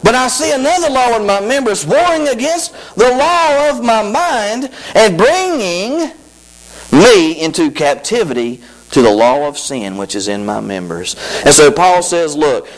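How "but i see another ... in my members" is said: high, slowed, intoned verse; scripture reading again. Shown as a demonstration. Preacher rereading verse, slower and intoned. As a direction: draw out the verse with emphasis. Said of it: "He said it".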